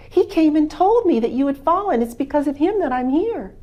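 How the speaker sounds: Farmer Green, eager. Acted. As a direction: explain eagerly what the dog did.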